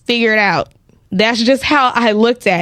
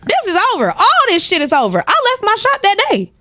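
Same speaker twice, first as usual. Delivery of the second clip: high, excited declaration; thrilled triumphant exit; elated; 12 semitones higher than usual. Retold or imitated. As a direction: announce you're done for good. Euphoric quitting declaration.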